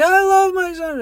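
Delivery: high mock wail; crying. Crying woman answers about her son, imitated high wail. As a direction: blurt it as a tearful, high whine. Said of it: "then she starts crying again".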